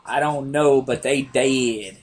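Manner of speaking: lightly voiced kid, slow and drawn out; little kid. Orphaned kid answering the man, lightly imitated little kid. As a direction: stretch the words like a deadpan movie child. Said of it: "the kid's like".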